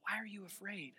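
hushed, soft voice of God; soft, searching questions; gentle questioning. Acted. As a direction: ask it barely above a murmur, unhurried. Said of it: "more of a question".

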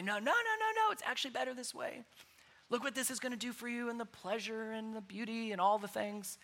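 soft, coaxing, sly devil voice; tempting devil. Retold or imitated. Preacher voicing the devil enticing people, sly and soft. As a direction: whisper the temptation sweetly. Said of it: "love to tempt us and entice us and say".

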